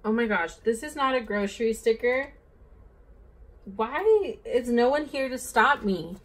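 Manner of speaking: loud, high-pitched dismay at own mistake; exasperated outburst. Real, not acted. Vlogger realizing she used the wrong sticker, exasperated outburst. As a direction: cry out in exasperated disbelief at yourself.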